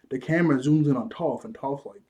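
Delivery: usual speaking voice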